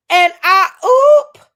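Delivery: high, loud yelp on "oop"; mock a scandalized crowd, popping the last word way up; shocked chat reaction. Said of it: "all three hundred of us in the chat was like".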